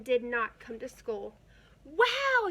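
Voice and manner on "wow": put-on high, loud kids' cheer; excited yell. A narrator's reading. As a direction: shout it with glee. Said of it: "yelled the kids".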